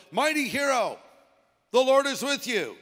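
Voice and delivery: high, loud proclamation; heralding angel. Acted. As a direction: announce it grandly, like an angel hailing someone. Angel's greeting read aloud.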